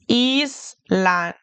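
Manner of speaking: Island with ee, Eesland; Island pronounced the German way. Shown as a demonstration. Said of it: "German I sounds like an E".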